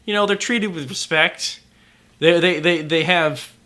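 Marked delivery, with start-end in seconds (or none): none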